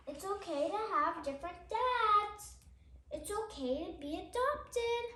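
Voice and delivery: steady, gentle picture-book reading; reading to children. Acted. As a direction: read each page out to little listeners. Reads picture book aloud.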